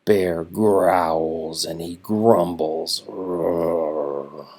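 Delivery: low, slow growl ending in a low roar; growling bear. A narrator's reading. Reading to children, low bear growl and roar. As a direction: rumble like a grumpy bear.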